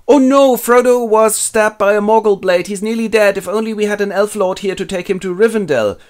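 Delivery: high, loud hammed-up alarm; mock panic. Aragorn over wounded Frodo, high mock panic. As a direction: wail it in exaggerated distress.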